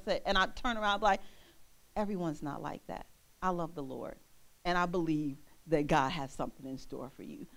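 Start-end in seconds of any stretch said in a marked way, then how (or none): 2.0-7.5 low, soft, sincere aside; gentle reassurance. Retold or imitated. Turning to reassure someone put off, gentle and low. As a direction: quietly reassure them, voice low.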